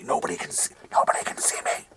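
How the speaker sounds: imitating a sneaky buyer's whisper. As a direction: hush it like you're hiding from everyone. Mocking sneaky garage-sale buyer.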